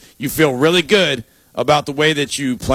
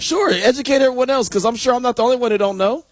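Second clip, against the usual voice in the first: mock indignant; 8 semitones higher than usual.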